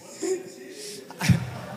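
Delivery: high breathy nervous giggle; nervous laugh. Real, not acted. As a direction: laugh it off, flustered. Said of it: "Sorry, I'm nervous".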